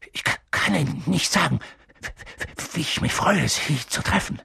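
nervous, breathy stutter; stammering. A narrator's reading. Professor grasping Harry's hand, stammering. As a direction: catch on the consonants and push through. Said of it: "stammelte Professor Quirrell".

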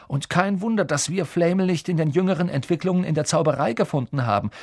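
Ron, lively. A narrator's reading. Ron working out why their search failed, lively.

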